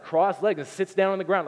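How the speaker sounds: normal talking voice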